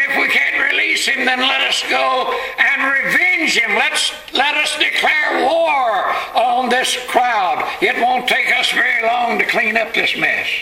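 high, urgent rallying cry; fired-up battle call. Acted. Angels urge war on crowd.

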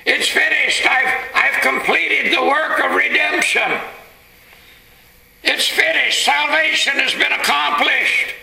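high, ringing preacher's proclamation; crying out triumphantly. Acted. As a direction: proclaim victory at a high, sustained pitch. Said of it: "Jesus cried out".

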